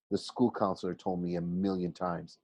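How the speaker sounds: ordinary delivery, nothing marked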